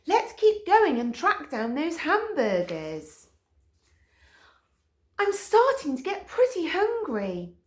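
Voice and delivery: a hungry dog urging the gang onward; high eager Stick Dog. A narrator's reading.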